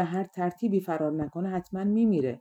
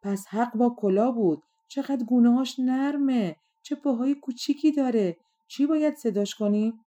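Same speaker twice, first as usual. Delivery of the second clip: delighted cooing, pitched higher; joyful; 6 semitones higher, 3 dB louder than usual. A narrator's reading. Frog admiring the newborn baby, joyfully. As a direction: coo happily over a baby. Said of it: "قورباغه با شادی گفت".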